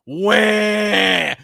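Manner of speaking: a loud, hammed-up crybaby wail; mock baby wail. Mocking whiny fans with a loud fake wail.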